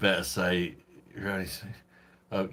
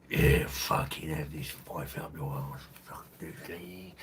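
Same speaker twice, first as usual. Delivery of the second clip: Doctor Birdie; 3 semitones lower, slower than usual. Acted. Streamer attempts a requested impression, slow put-on voice fading away. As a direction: improvise a slow caricature, fading out. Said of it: "Can we have a Doctor Birdie impression?".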